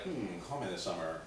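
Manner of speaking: low, soft pondering hum; tempted musing. Retold or imitated. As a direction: mull it over to yourself, quietly. Mulls over the note, tempted.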